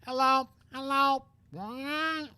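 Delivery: badly faked parrot squawk. Same parrot again.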